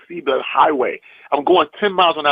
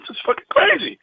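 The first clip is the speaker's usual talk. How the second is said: higher-pitched, mild acted astonishment; retold amazement; 10 semitones higher than usual. Comic to agent on landing the show, amazed. Reacts to getting the job.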